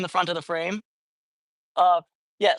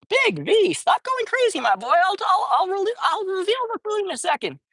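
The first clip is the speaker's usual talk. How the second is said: cooing pet talk; 10 semitones higher than usual. Real, not acted. Vlogger telling eager cat Big V to wait for food, high cooing. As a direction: soothe the impatient cat in a high coo.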